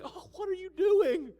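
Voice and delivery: high, loud, whiny protest; mock the fussing disciples in a strained voice.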